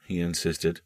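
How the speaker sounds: usual speaking voice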